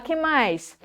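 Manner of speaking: usual speaking voice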